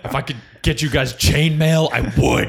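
loud, high, overblown commander's address; mock battle speech. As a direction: ham up a coach arming his troops. Mock coach rallying kids for battle.

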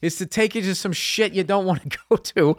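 venting his frustration at her; exasperated rant. Real, not acted. Husband venting about his wife, exasperated and loud.

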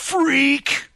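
high, drawn-out mock jeer; mock insult. Cop to the man he fears, mock-scornful cry.

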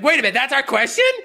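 high, loud, quick teacher voice; high and loud, Garrison caught off guard; Mr. Garrison impression. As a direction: rattle it off as a shocked cartoon teacher. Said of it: "Mister Garrett's like".

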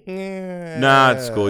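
normal talking voice

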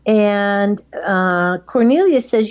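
ordinary delivery

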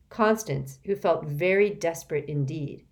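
ordinary delivery

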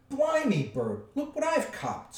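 high, loud troll exclamation; excited troll. A narrator's reading. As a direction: crow over a surprise catch. Troll announces his catch.

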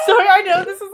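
high, giggling apology; can't stop laughing; helpless laugh. Real, not acted. Host giggling and apologising for laughing. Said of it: "but I can't".

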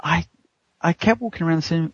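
ordinary talk

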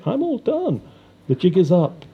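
high taunting lilt; mocking singsong. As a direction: tease them, playfully. Captured killer taunts detective.

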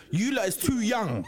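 normal talking voice

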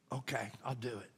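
the son gives in under his breath; quiet acceptance. Retold or imitated.

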